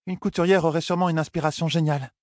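put-on high-pitched, tactful young woman; lightly voiced diplomatic suggestion; diplomatic Elaine. A narrator's reading. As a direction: offer it delicately in a high feminine voice. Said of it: "avança très diplomatiquement Elaine".

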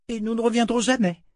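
put-on high child voice, resolute; a girl vowing never to return; determined little girl. A narrator's reading. Rachel à la poupée. (voix de fillette résolue)

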